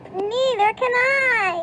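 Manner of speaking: howl. A narrator's reading. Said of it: "Howled Flitter".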